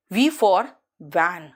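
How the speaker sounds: teacher showing the V sound; bitten v demo. Shown as a demonstration. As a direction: bite the lip on van. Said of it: "bite करते हो थोड़ा सा".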